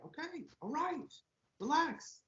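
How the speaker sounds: calm the ranting fan down; mock soothing reply. Host voicing someone soothing the angry fan, soft and high.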